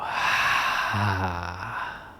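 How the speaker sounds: long, voiced exhale trailing away; audible sigh. Shown as a demonstration. Meditation teacher showing the group how to exhale, audible sigh. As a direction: let the breath out in a drawn-out sigh. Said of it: "three sighs. So making a sound on the exhale".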